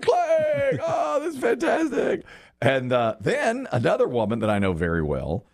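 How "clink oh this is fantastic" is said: hammed-up fake joy greeting; fake delight. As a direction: pretend to be overjoyed at seeing an old friend. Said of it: "I was like".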